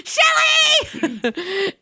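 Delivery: high laughing shout. Real, not acted. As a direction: break up laughing mid-word. Laughing fit while reading.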